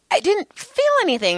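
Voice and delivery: very high retold insistence; baffled protest. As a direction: insist in squeaky-high disbelief. Repeating her protest to the dad.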